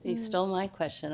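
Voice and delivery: normal talking voice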